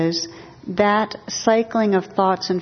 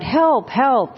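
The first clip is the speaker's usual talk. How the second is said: higher-pitched mild mock cry for help; retold cry of terror, kept light; mock terrified scream; 6 semitones higher than usual. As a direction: play at screaming for help without real volume. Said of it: "in terror, he screams out".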